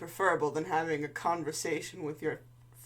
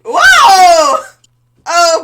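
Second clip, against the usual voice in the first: high, shouted excited whoa; loud whoa; 13 semitones higher, 24 dB louder than usual. Real, not acted. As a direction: yell it out, thrilled. Reacting to the kick.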